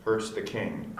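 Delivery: usual speaking voice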